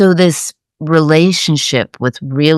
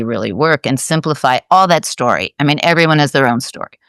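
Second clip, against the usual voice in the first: normal talking voice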